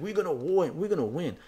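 mock cheering pub crowd; mocking drunk yahoos. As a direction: gloat like a drunk pub fan. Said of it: "Bunch of yahoos over there turned to me and said".

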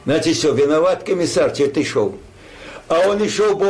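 usual speaking voice

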